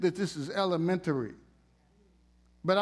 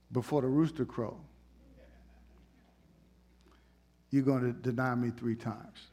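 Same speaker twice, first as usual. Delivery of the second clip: calm Jesus; 6 semitones lower, 4 dB quieter than usual. Retold or imitated. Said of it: "Jesus said".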